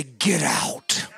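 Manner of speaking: low, drawn-out giving up; defeated dismissal. Acted. The devil finally turns loose of a believer, defeated. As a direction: let it sink low, as if you've had enough.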